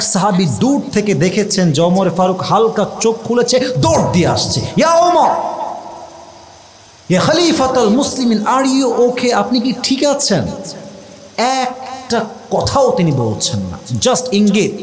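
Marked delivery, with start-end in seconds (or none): 4.8-10.5 high, alarmed acted call; urgent calling out. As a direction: call out to the wounded caliph, pitched high. Companion runs up calling out. (ছুটে এসে উৎকণ্ঠায় ডাকার অভিনয়)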